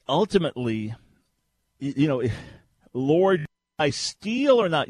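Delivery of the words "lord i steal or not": high, loud, drawn-out mock plea to God; mock pleading prayer. Host imitating a believer asking God about stealing, mock plea. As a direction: ask it as an absurdly earnest prayer.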